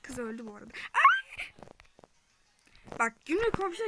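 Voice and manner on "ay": piercing high scream; sudden terrified shriek. Real, not acted. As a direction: let out a shrill scream of fright. Scream in game.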